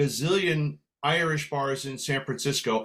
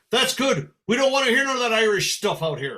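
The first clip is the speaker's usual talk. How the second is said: quick, higher, emphatic pub voice; dismissive regular; 6 semitones higher, 3 dB louder than usual. Retold or imitated. Pub regular rejecting Irish music, emphatic dismissal. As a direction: lay down the law briskly. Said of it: "He says".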